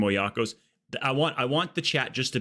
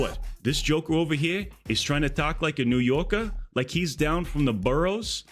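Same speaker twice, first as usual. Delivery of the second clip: indignant New York tough guy, pitched up; put-on New York accent, full of mock threat; 3 semitones higher than usual. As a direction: rant like an offended New Yorker. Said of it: "there was a guy in New York was like".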